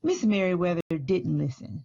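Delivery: read-aloud picture book voice, unhurried; story narration. Acted. School librarian reading Library Lion aloud to children, gentle storytelling. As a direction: tell the story to a room of kids.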